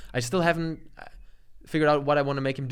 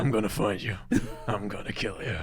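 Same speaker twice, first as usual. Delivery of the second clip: lower, deep, menacing; Liam Neeson Taken threat; 7 semitones lower, 5 dB quieter than usual. Retold or imitated. Friend reading Taken quotes in a deep voice. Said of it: "Liam Neeson Taken quotes".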